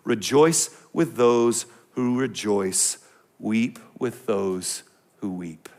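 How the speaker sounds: slow, soft, reverent reading; solemn scripture reading. Acted. Preacher reading the verse to his congregation, slow and reverent. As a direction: lay the verse down gently and slowly.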